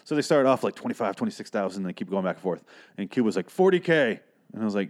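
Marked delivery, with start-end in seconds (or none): 3.5-4.2 a high, loud bid called out; husband calling out the winning bid, high and loud; mock bid shout. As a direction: call it out sharp, outbidding the room. Quoting Cuba's winning bid.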